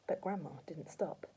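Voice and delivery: usual speaking voice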